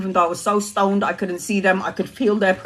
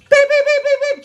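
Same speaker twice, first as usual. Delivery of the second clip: very high beeps; imitated beeps; 3 dB louder, faster than usual. Teller mimicking the ambulance machine with very high beeps.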